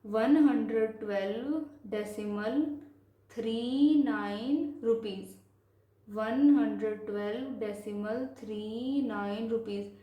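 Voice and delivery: measured, drawn-out number reading; slow reading demonstration. Shown as a demonstration. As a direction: stretch "three" and "nine" as separate digits. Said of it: "तो इसको कैसे पढ़ेंगे".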